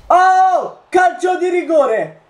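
sudden high shout of surprise; surprised shout. Real, not acted. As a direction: yell it out in shock. Penalty awarded, shouts. (grido sorpreso)

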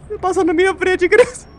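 high-pitched gleeful teasing; high excited teasing. Real, not acted. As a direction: squeal it at a friend. Gloating high.

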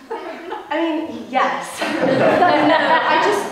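flustered laughing. Real, not acted.

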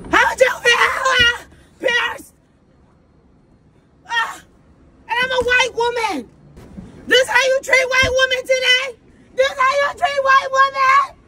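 high, whiny mock sobbing. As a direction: wail it like a fake-crying victim.

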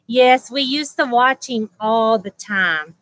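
ordinary talk, nothing marked

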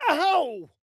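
sharp high ow of pain; sudden hurt cry; pained yelp. Acted. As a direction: yelp as if struck.

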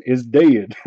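ordinary delivery

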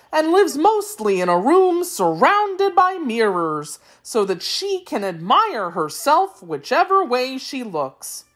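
high, singsong creature voice with big swoops; explaining Wheeler. A narrator's reading. Wheeler explaining the royal family, high singsong voice. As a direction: tell it in exaggerated, swooping tones.

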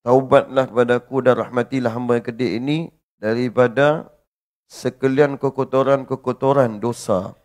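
loud, pleading supplication to God; a preacher's loud heartfelt plea; fervent prayer. Acted. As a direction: cry out to God for forgiveness. Loud supplication after the verse.

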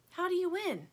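high little character voice; curious toy buffalo. A narrator's reading. Lumpy asks Stingray about the game, puzzled.

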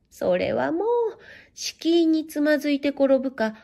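plain reading voice

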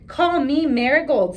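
lightly voiced excited boy; eager boy. Joe wants flower name.

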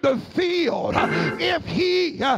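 rhythmic whooping sermon, each phrase hammered out over the organ; preaching at full force. Acted. Preacher urging the congregation to sleep and trust God, full whooping sermon. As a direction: rise into a sung preacher's cadence and drive it home.

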